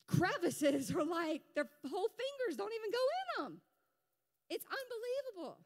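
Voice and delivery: high, fast amazed exclaim. Real, not acted. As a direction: voice shoots up in disbelief. Amazed at tiny crevices.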